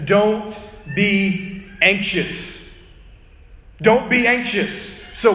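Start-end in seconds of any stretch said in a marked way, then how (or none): 0.1-2.7 very slow, drawn-out command; very slow quote. Retold or imitated. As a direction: stretch every word out. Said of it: "He says".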